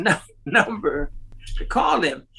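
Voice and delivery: ordinary talk, nothing marked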